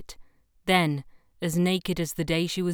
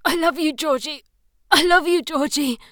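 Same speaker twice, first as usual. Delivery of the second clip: high loud passionate cry; 10 semitones higher, 8 dB louder than usual. Acted. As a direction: cry it out in ecstasy. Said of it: "He cried out for her".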